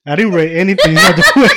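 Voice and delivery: loud laughing words; giggling as he talks. Real, not acted. Laughing during game.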